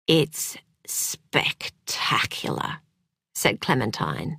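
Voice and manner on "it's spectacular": hushed whisper. Acted. Delighted hushed praise after tasting.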